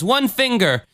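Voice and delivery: high-pitched amazed answer; healed woman. Acted. Lady in glasses answers the healer, high acted voice. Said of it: "and she goes".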